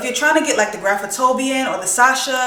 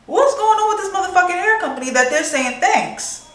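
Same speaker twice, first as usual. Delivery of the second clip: baffled outrage; 9 semitones higher than usual. Retold or imitated. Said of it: "So I'm like".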